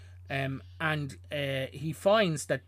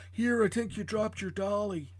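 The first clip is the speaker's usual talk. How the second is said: higher, earnest kindly policeman; gentle cop voice; 7 semitones higher than usual. Acted. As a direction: play the sweet helpful cop. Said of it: "That was your best performance all night".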